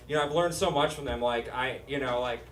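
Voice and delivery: ordinary talk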